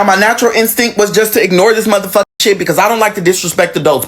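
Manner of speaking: usual speaking voice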